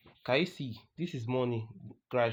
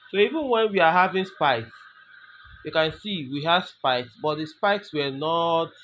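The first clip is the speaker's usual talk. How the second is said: animated explaining; 4 semitones higher, 6 dB louder than usual. Real, not acted. Excited explaining spikes.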